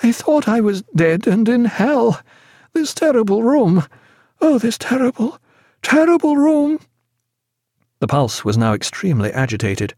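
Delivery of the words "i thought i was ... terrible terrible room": high, rising terror, loudest on the end; delirious horror. A narrator's reading. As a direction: let the dread build as it goes.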